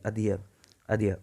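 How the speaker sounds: adhere repeated as "ad-hee-ya"; model pronunciation. Shown as a demonstration. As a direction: say adhere with the final r dropped. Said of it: "उसको adhere बोलोगे".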